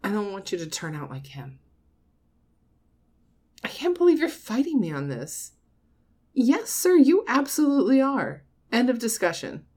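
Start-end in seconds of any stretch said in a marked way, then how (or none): 3.6-9.6 raised, higher voice, insisting; indignant scolding. Acted. Mother overruling her grown child's objection, indignant. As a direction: shut down the argument forcefully.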